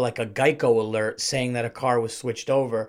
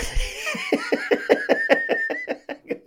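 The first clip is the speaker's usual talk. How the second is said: very high, squealing laugh; long real laughing fit; helpless laughter. Real, not acted. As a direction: crack up at your own story. Cracks up at own lie.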